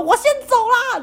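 high, playful parting line; a cheeky toss-off as you ride away; breezy. Retold or imitated. Narrator tells fallen friend she's leaving, high playful voice.

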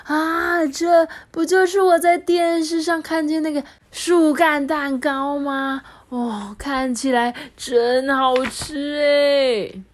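put-on high, slow thrilled old lady; super excited granny. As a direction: gush over the cake. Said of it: "银婆婆就超兴奋的说".

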